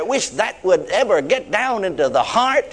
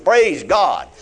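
ordinary talk, nothing marked

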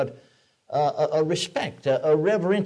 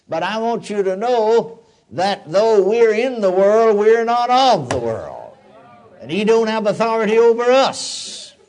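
louder, slower, ringing declaration; fervent preaching; 5 semitones higher, 5 dB louder than usual. Acted. Preacher proclaiming freedom from Satan to the church, fervent. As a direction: proclaim it to the congregation.

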